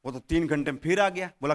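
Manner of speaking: ordinary talk, nothing marked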